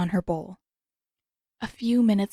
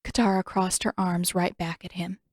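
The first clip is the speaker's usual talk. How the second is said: usual speaking voice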